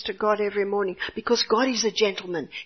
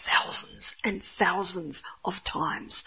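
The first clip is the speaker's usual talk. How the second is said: whisper; 3 semitones lower, 6 dB quieter, slower than usual. Retold or imitated. Exasperated mother retelling.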